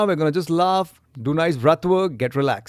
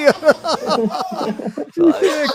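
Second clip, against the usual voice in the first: pinched voice; 10 semitones higher than usual. Real, not acted. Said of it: "Kid voice".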